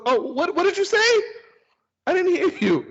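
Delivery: put-on high, loud kid's excuse; imitated backtracking daughter. Daughter correcting herself to dad, high imitated pleading. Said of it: "She goes".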